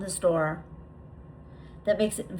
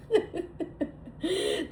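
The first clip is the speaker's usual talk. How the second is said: high little laugh; spontaneous giggle mid-sentence; 10 semitones higher, 3 dB quieter than usual. Real, not acted. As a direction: break into a brief high-pitched chuckle. Laughs mid-meditation at cavern idea.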